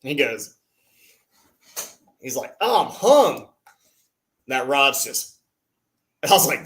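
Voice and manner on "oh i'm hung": higher-pitched imitation of a friend; retold snag call. Imitating a friend who thinks his lure snagged, light dismay. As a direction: lightly mimic a buddy's complaint.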